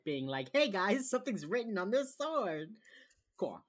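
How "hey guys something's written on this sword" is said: high, loud mock alert to the group; mock announcement. Host mimicking someone examining a sword for colleagues, mock announcement. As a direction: call it out brightly, sending it up.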